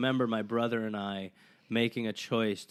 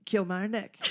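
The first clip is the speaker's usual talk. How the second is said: higher, rising fake Scottish accent on "Kilmarnock"; 9 semitones higher than usual. Drunk brothers faking Scottish to a local, rising Scottish accent. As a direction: bluff a place name in a put-on Scottish accent. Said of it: "we're being Scottish".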